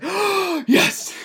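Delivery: high gasp into a loud yes; retold triumphant cry. Player finding the saving ability, gasping triumphant yell. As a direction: burst out in mock-triumph, imitating the player. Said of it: "He's like".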